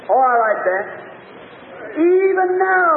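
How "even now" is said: high, drawn-out preacher's cry; acted outcry of faith; fervent plea. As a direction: stretch the words out on a raised pitch.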